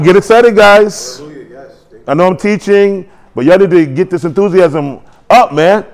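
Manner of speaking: ordinary delivery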